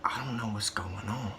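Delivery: hushed whisper so the waiting room can't hear; embarrassed whisper to the receptionist; whispered confession. Retold or imitated. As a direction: lean in and whisper it. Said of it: "lean in and whisper it".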